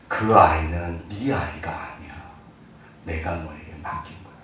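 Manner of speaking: very slow, soft and low; God gently answering a weary parent; slow tender answer. Acted.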